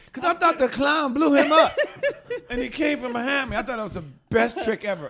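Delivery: usual speaking voice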